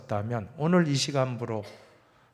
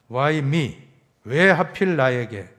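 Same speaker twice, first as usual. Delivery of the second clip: imitated complaint; 4 semitones higher, 6 dB louder than usual. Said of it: "하면서 원망하고 불평하였을".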